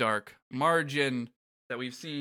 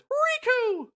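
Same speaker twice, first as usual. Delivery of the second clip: Mickey Mouse calling; 4 dB louder than usual. Acted. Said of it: "Mickey says".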